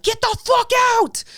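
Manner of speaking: high, loud shout of anger; retold yell. Pushing the guest down the stairs, yelling high and loud. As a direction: throw him out with your voice.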